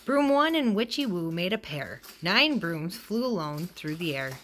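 ordinary delivery, nothing marked

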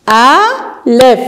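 very slow, split into syllables; syllabified slowly. Shown as a demonstration. Teacher splitting a word into syllables, very slowly. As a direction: break the word into beats.

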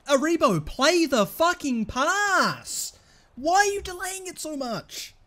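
high, loud, slow yell to make the pass; real frustrated shout at Aribo; angry yelling. Real, not acted. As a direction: bark at the player to pass it.

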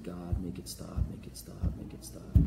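low, soft plea fading to whisper; =a hushed, desperate prayer; mock-tearful praying. German soldier in the trench begging God, hushed praying. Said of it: "they're crying, and they're saying".

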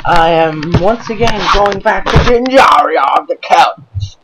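loud, drawn-out greeting to the audience; grand announcer shout. Acted. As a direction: belt out a big episode intro, stretching the words. Loud theatrical intro to the episode.

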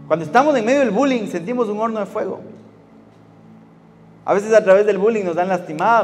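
loud, building sermon voice; preacher to teens about bullying, building in loud fervour; impassioned preaching. Acted.

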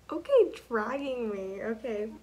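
loud protest. Real, not acted. Cat gets dragged along.

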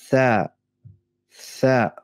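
"Tha" mit Zunge zwischen den Zähnen; "Tha" wie ein gelispeltes T; lispelndes Tha. Shown as a demonstration. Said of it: "ein lispelndes Ta". ("Tha" lispelnd, Zungenspitze sichtbar)

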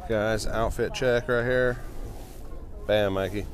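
usual speaking voice

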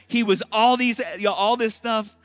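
high, loud, emphatic preacher; full-force preaching. Acted.

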